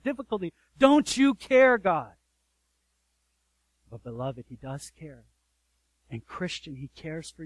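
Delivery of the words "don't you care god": higher, louder, slow plea; anguished cry to God. Retold or imitated. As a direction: drag out the desperate question. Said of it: "instinct of our human hearts".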